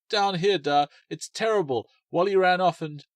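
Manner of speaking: high, loud distressed shout; a girl shouting up to her father. Acted. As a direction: call up to her dad in distress.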